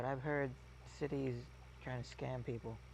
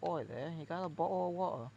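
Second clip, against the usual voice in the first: mock Cockney; 4 semitones higher than usual. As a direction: hail a stranger in a hammed-up London accent. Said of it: "It's more the".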